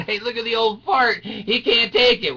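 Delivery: high-pitched mocking kids; jeering teenagers. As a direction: jeer like teens at an old man. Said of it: "all the, the teenage kids are laughing and going".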